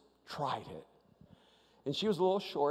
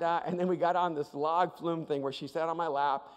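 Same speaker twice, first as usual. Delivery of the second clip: normal talking voice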